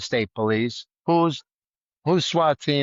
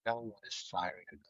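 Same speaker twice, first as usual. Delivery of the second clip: ordinary talk, nothing marked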